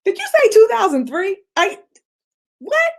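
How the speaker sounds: can't believe what you just heard; mock shocked disbelief; incredulous. Incredulous reaction.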